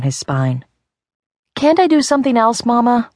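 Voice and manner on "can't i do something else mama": high, fast, whiny plea; scared little boy pleading with mama. A narrator's reading. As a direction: beg not to do the chore. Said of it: "Arlo pleaded".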